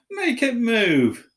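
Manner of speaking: high, slow spoilt whine; whining Dudley. A narrator's reading. Dudley whining at his father to make the snake move. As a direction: drag it out like a spoilt boy. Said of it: "he whined at his father".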